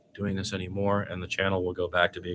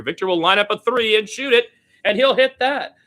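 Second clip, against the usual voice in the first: much higher and louder, a burst of excitement at the play; excited call; 14 semitones higher, 7 dB louder than usual. Real, not acted. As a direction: shout it out as the pass finds him. Three-pointer going in.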